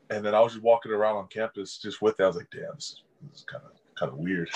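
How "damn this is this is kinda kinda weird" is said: hushed and quick to himself; self-conscious murmur, faster; muttered aside. Retold or imitated. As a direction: mutter it under your breath, a bit embarrassed.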